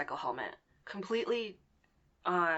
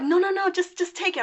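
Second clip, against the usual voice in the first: insistent scammer; 12 semitones higher, 9 dB louder than usual. Retold or imitated. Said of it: "he's like".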